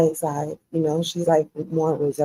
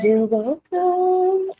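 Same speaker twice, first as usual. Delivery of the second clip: high sing-song; 13 semitones higher, much slower than usual. Pretend. Sing-song mock reply.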